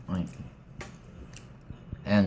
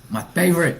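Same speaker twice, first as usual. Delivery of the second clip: excited; 9 semitones higher, 8 dB louder than usual. Real, not acted. Excited candy intro.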